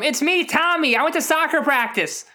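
put-on high little-kid voice; sheepish child calling home; kid on phone. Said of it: "accept a collect call from".

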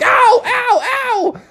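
very high, sharp ow cries; yelps of pain. Acted. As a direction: yelp as pitchforks poke you. Poked with pitchforks.

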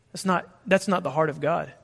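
normal talking voice

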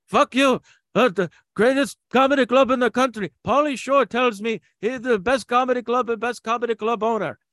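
put-on shouted high and loud in a thick Lebanese accent; Lebanese-accented heckle yell. Club owner heckling comic from the back, loud Lebanese-accented yell. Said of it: "he would take the microphone ... and go like".

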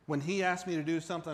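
normal talking voice

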